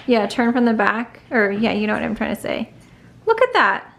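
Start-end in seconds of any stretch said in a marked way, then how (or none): 3.3-3.8 excited. Real, not acted. Showing off arms.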